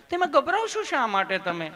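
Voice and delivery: high put-on nurse voice; sweet, loving nurse. Nurse asking why the patient is nervous, high and sweet. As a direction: reassure coaxingly, pitched right up. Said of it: "તમે કેટલું પ્રેમથી પૂછો છો".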